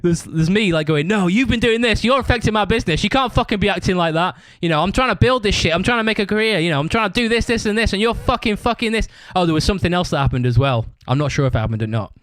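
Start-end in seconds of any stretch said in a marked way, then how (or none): 1.1-9.1 acted-out argument. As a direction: replay your side of a heated row. Acts out his own argument.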